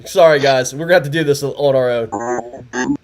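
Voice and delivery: usual speaking voice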